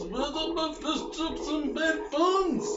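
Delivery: high mock British voice; mock British accent. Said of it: "in that accent".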